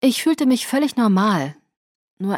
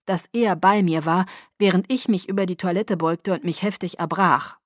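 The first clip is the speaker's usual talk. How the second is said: plain reading voice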